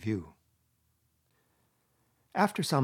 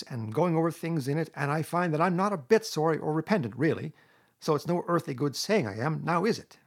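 high, louder self-justifying Toad; impatient; 6 semitones higher, 5 dB louder than usual. A narrator's reading. Toad excusing his false promise to badger, impatient. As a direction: brush it all aside, high and insistent. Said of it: "said Toad impatiently".

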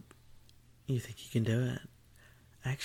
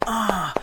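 sudden high loud cry; sharp cry; 10 semitones higher, 10 dB louder than usual. Acted.

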